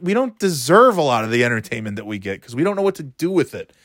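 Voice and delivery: normal talking voice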